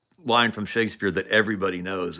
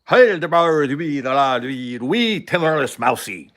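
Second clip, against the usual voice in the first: loud mock Scottish gibberish, higher than usual; Scottish accent; 3 semitones higher, 7 dB louder than usual. Scotsman answering a barbecue invitation, in a thick Scottish accent. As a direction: rattle off a thick, barely intelligible Scottish burr. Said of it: "he's Scottish".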